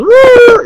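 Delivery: very high, loud, long "woo"; piercing drawn-out falsetto whoop; game character's whoop. Retold or imitated. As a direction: imitate a jumping hero's holler. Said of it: "but then he goes".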